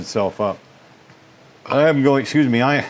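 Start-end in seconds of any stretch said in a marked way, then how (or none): 1.6-2.8 words broken by a burp; burping while talking. Real, not acted. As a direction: talk through a burp. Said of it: "I'm burping".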